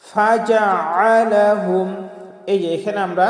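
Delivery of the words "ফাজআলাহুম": "ফাজআলাহুম" chanted slowly with a throaty ain; correct ain. Shown as a demonstration. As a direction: draw out "ফাজআলাহুম", middle a from the throat. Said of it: "হামজা বানিয়ে ফেলি". ("ফাজআলাহুম"-এ আইন স্পষ্ট করে ধীরে)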